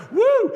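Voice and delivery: high, loud whoop; big high-pitched holler of support. Retold or imitated. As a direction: let out a sudden loud woo. Acted whoop in reply to Cruz.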